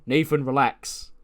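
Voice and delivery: slow it down, calm your partner. Retold or imitated. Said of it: "And Axiom goes".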